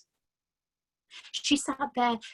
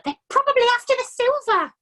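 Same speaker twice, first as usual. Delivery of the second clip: much higher, louder bossy urging; wife pushing husband to act, urgent; urgent mother; 10 dB louder than usual. A narrator's reading. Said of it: "hissed the mother".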